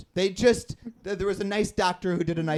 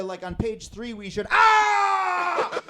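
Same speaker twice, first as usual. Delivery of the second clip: sudden pain yelp; 8 semitones higher, much slower than usual. Retold or imitated. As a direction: break into a yelp mid-sentence. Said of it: "talking to one of my coworkers".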